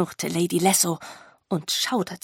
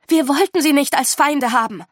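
loud, raised-pitch protest; calling out; 7 semitones higher, 8 dB louder than usual. A narrator's reading. Agatha denying she wanted enemies, calling out. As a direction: exclaim it as a plea. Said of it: "rief Agatha".

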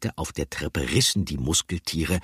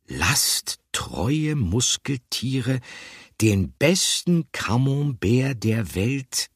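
very slow, higher, weighing each word; slow dreamy murmur of a cheese lover; pondering slowly; 7 semitones higher, much slower than usual. A narrator's reading. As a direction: drag it out while searching for the word. Picandou searches for missing word.

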